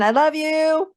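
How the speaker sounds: affectionate calling. Real, not acted. Listener answers the dragons' love, high affectionate coo. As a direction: let the words rise high and linger.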